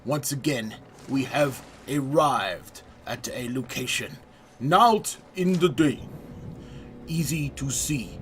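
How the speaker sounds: playful, hammed-up French accent narrating the pirate adventure; a self-confessed terrible French accent, overplayed for laughs; mock French accent. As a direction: put on a deliberately bad French accent, like a cheesy pirate guide. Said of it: "I have a terrible French accent".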